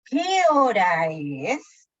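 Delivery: "¿Qué hora es?" very slow, rising then falling, loud; question intonation curve. Shown as a demonstration. Teacher showing a melodic curve, exaggerated slow question intonation on "¿Qué hora es?". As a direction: stretch "¿Qué hora es?" over a big rise and fall. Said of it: "Es una curva melódica".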